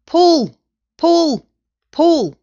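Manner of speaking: loud, high repeated yells; rhythmic high-pitched hollering, each word separate; yelled command. A narrator's reading. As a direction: drive the team on with each shout. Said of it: "yelled Mugglewump".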